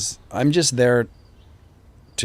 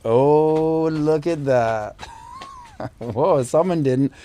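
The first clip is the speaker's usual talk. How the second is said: higher, sing-song voice for his son; 6 semitones higher, 3 dB louder than usual. Real, not acted. Greeting his son arriving on camera.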